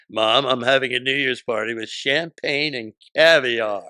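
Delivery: loud, self-important imitation; full of herself. Daughter announcing her New Year's party, full of herself. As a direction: imitate a daughter showing off, voice projected. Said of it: "she was a little full of herself".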